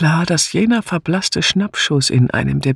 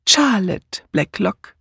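"Charlotte" slow and raised in pitch; pointed correction; 5 semitones higher, slower than usual. A narrator's reading. Old sleuth correcting the sergeant, slow weight on "Charlotte". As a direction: say "Charlotte" deliberately, drawn out and high. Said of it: "verbesserte Miss Marple ihn".

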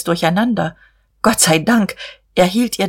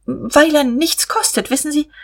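much higher-pitched, knowing aside; sudden pointed remark; 9 semitones higher than usual. A narrator's reading. Guste explains to Diederich with a sudden look, high and pointed. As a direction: lift the voice high, hinting. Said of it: "mit einem plötzlichen Blick".

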